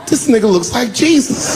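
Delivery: high, shouted amazement; awestruck exclamation. Retold or imitated. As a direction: blurt out your awe at the sight. Awestruck audience member at debate.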